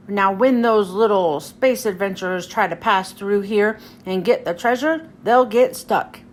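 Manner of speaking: low, scheming cartoon villain; Pete voice. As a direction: gloat about the trap in a low voice. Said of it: "says Pete".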